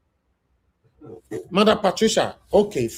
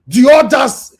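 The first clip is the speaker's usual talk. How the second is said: loud, raised, preaching scold; impassioned shout; 4 semitones higher, 3 dB louder than usual. Real, not acted. Host lecturing the commenters on their lack of sympathy, shouting. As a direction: drive it home, loud and heated.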